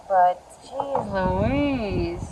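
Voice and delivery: very slow, stretched exclamation; drawn-out exasperation. Real, not acted. Gardener recovering from a gust, drawn-out exasperation. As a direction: drag the words out after a small scare.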